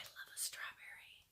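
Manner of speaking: fond whisper. Real, not acted. Vlogger confessing love of wool strawberries, fond whisper. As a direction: breathe it out lovingly.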